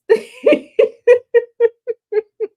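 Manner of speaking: loud high cackle; high burst of laughter. Real, not acted. Host cracking up at own joke, laughing loudly. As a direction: break into a real high laugh.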